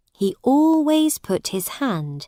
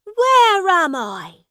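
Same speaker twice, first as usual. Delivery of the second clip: high, loud, drawn-out question; bewildered prince; 11 semitones higher, 5 dB louder, slower than usual. A narrator's reading. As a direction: demand it in a high, slow prince's voice. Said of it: "asked the prince".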